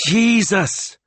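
very high-pitched exclamation; awestruck cry. A narrator's reading. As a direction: cry out as if seeing a miracle. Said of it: "cried Signora Barbaro".

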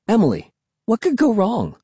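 put-on high, carefree kid voice; breezy reassurance. A narrator's reading. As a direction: wave away a worry lightly. Breezy kid dismisses sister's worry.

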